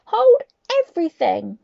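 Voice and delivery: high, loud witch shriek. Acted. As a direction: shriek the order to freeze. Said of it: "Her shrieking voice echoed".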